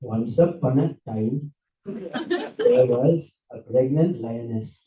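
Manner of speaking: low, slow storytelling guru voice; hammed-up guru, slow and low; Israeli guru. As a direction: draw it out like a backpacker guru. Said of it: "an Israeli backpacker guru. So he said this".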